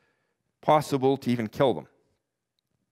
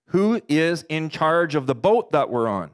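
usual speaking voice

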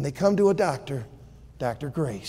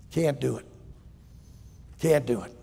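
calm refusal; 6 semitones lower, 8 dB quieter than usual. Acted. Doctor Law refuses.

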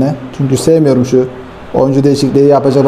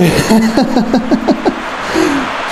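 long high burst of laughter, softer; high relieved laugh; 9 semitones higher, 4 dB quieter than usual. Real, not acted. Gamer after a last-second winning goal, laughing in high bursts. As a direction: laugh out in disbelief.

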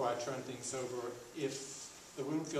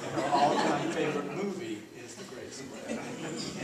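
louder, chuckling through the punchline; laughing; 2 semitones higher, 8 dB louder than usual. Real, not acted. Host telling audience the joke, laughing. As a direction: let the laugh break into the joke. Joke about repeated favorite.

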